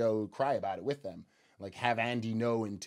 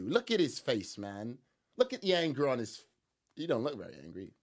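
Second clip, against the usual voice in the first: normal talking voice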